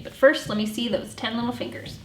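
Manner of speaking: usual speaking voice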